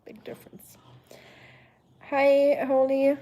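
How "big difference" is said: a soft unvoiced whisper after a laugh; whispered aside. Real, not acted. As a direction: breathe it as a private aside. Laughing aside after correcting herself.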